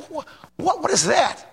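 high-pitched stammered question; mock bafflement, stumbling on the first word; baffled disbelief. Preacher reacting to smoking while preaching, baffled disbelief.

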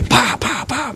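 lightly voiced gunfire; mock gunshots. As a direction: imitate shooting with your mouth. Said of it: "some of you'd be".

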